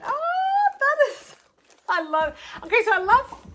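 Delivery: high, loud gushing; excited voice. Real, not acted. Crafter gushing over a new ornament, high excited voice. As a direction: burst out high and loud with delight. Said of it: "got the excited voice".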